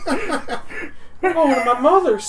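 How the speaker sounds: high, slow line broken by laughter; laughing husband's high, slow whine. Acted. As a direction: drag it out while cracking up. GM voices husband fleeing.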